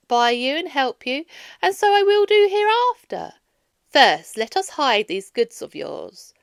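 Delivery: goddess laughing. A narrator's reading. Athena teases Odysseus for his tall tales, amused and loud. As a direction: tease him fondly, then reassure. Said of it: "she laughed and said".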